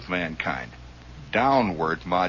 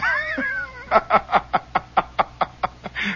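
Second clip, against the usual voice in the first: long, very high giggling cackle. Real, not acted. Storyteller laughing at his backwards transmitter, cackling high. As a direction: squeal off into helpless high laughter.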